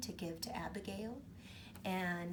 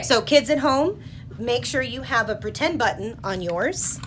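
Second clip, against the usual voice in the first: loud host; 3 semitones higher, 15 dB louder than usual. Real, not acted. Host addressing kids at home.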